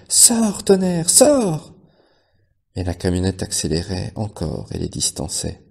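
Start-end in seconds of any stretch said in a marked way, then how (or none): 0.1-1.7 high, slow anguished shout; urgent repeated cry. A narrator's reading. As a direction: beg him to get out. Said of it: "répétèrent le cri".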